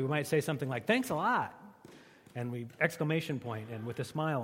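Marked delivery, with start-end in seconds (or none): 0.9-1.5 bright, sincere thank-you. Shown as a demonstration. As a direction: say it and mean it, with a smile. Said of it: "if we're offering gratitude sincerely".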